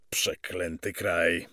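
slow, weary-sounding oath; drawn-out bitter aside; muted curse. Acted. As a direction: curse the country slowly to yourself. Officer curses the country.

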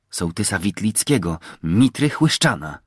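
usual speaking voice

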